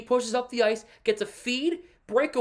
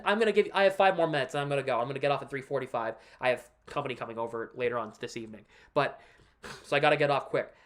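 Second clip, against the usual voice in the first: quick muttered aside; 7 semitones lower, 3 dB quieter, faster than usual. Real, not acted. Rushed aside about leaving.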